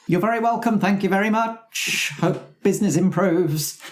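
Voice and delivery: high, polite shopkeeper voice. Acted. Shopkeeper thanking Gundel for the lovely sign. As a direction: chirp a cheery farewell.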